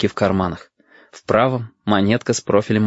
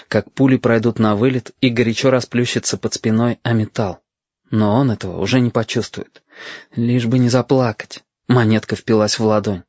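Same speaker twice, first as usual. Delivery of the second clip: plain reading voice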